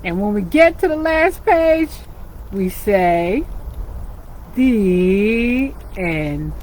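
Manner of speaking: lively storybook reading for little ones; animated picture-book narration; reading to children. A narrator's reading. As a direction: read it like storytime with kids.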